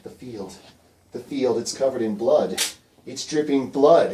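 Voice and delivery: slow, loud vision of dread; horrified Fiver. Acted. Storyteller voices Fiver seeing a bloody field, horrified. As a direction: build from a hush to loud, drawn-out horror.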